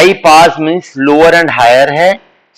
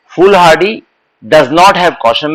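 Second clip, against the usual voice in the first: slow, word by word: foolhardy does not have caution; slow model sentence; much slower than usual. Shown as a demonstration. Teacher repeating the analogy slowly for students. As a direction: lay it out slowly, one word at a time. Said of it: "बेटा ऐसे निकालो".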